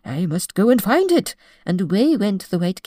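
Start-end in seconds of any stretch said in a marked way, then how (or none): 0.0-1.3 louder, hurried fuss; a hint of a panicked old king; suddenly remembers his latchkey; flustered king. A narrator's reading.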